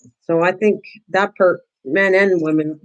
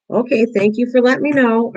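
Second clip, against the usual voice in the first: higher, gentle thank-you to a barking dog; polite thanks to the dog; 6 semitones higher than usual. Shown as a demonstration. To her barking dog, as if to a human: higher, gentle thanks. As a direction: thank the dog like a person, kindly. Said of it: "I talk to it like it's a human being".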